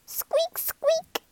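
high tiny squeaks; rat squeak. A narrator's reading. Rat as the boat sinks, high squeaks.

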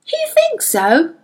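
sceptical, rising retort; a touch of offended challenge; mild flash of anger. A narrator's reading. Girl bristling at the boy's warning, mildly indignant. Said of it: "her anger flashed".

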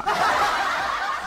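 laugh. Real, not acted. Laughs at own story.

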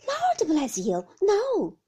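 high, loud exclamation; loud, high-pitched protest; emphatic cry. A narrator's reading. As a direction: cry it out in astonished denial. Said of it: "cried Peggotty".

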